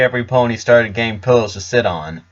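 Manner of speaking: usual speaking voice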